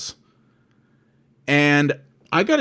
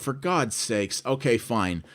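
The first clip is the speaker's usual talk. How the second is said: resigned sigh; 3 semitones lower, 8 dB quieter, slower than usual. Retold or imitated. Exasperated caller giving in.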